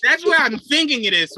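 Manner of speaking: normal talking voice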